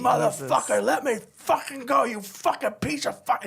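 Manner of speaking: high, strained mock-furious yell; retold shouting. Drunk man pinned down by the bouncer, imitated furious shouting. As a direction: yell it like someone pinned and struggling.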